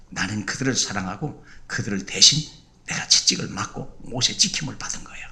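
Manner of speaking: low, soft, breathy whisper; whispered voice of Jesus. Acted. Jesus tells the believer how he loved his enemies, whispered. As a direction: speak as Jesus, hushed and intimate. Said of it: "야".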